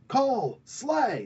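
high ringing call; battle cry. Retold or imitated. Brule urges Kull to kill the assassin, battle cry. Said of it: "He does this every so often".

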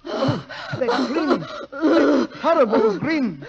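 recoiling at the sight of the dead grin; horrified. Acted. Brother sees the dying grin.

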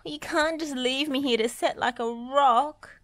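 high distressed plea; shrieking. A narrator's reading. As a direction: plead in a squealing little voice. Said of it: "shrieked Dizzy".

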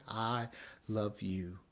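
hushed, gentle I love you, love said softly; tender and soft. Acted. Preacher voicing God's gentle love to the congregation, soft. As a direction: breathe it out like a tender promise.

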